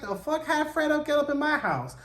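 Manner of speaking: high-pitched mock indignation; indignant disbelief. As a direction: voice him high and affronted. Corey spots Fred in his house.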